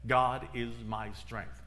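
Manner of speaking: slow whispered-in-spirit mantra, one step per phrase; slow affirmation. Retold or imitated. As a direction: repeat it like a step-by-step prayer. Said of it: "And her affirmation was".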